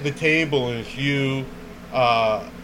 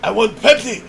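mimicked Chinese accent; 8 semitones higher, 4 dB louder, faster than usual. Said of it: "I start talking like him more".

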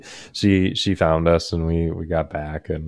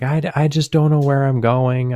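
higher-pitched imitation of a flustered grandma; 8 semitones higher, 4 dB louder than usual. Grandma lost on back roads tells callers, mock flustered. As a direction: lightly voice a confused grandmother.

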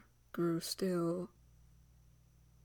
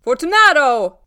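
loud, high shout of a name; big ringing holler; calling out; 7 semitones higher, 21 dB louder than usual. A narrator's reading. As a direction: call hard for a reply. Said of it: "I called again".

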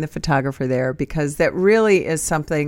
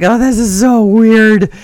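awkward; 5 semitones higher, 7 dB louder, slower than usual. Retold or imitated. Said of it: "And I was like".

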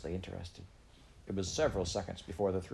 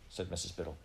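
ordinary delivery, nothing marked

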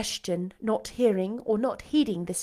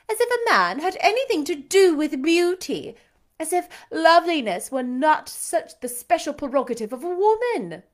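louder and higher, queenly commands; haughty lady commanding a singer; imperious belle; 6 semitones higher, 4 dB louder than usual. A narrator's reading. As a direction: order him about like a mock queen. Blanche's tirade against young men.

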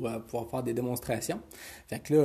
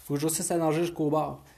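put-on high, upbeat kid voice, a bit louder; cheerful little boy; 5 semitones higher, 4 dB louder than usual. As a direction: tell it brightly like a proud child. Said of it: "Il dit".

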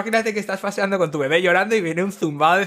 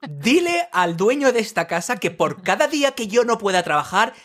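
slow, emphatic, higher-pitched threat; mock stern ultimatum; 4 semitones higher, slower than usual. Rehearsing a threat to noisy builders, slow mock indignation. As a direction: lay down the threat word by word.

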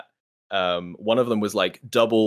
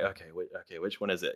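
much softer, hurried self-talk; flustered muttering; 4 semitones lower, 13 dB quieter, faster than usual. Retold or imitated. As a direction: mutter it under your breath. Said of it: "And I'm like".

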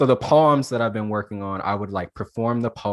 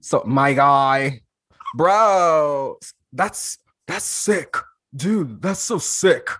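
loud, slow surfer-dude frat bro; 3 semitones higher, 5 dB louder, slower than usual. Shown as a demonstration. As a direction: play the chill frat bro, drawn out. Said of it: "I've been working on the accent".

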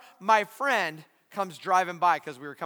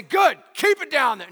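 mock God voice; 6 semitones higher, 8 dB louder than usual. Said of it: "God doesn't say".

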